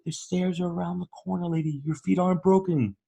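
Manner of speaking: high but soft, imitated comeback; acted put-upon workman; mock weary sarcasm. Worker's weary comeback to lady.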